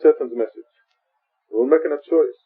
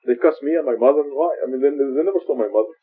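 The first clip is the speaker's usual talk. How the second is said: ordinary delivery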